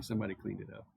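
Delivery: ordinary delivery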